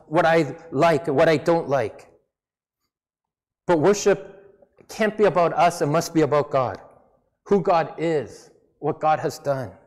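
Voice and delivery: ordinary delivery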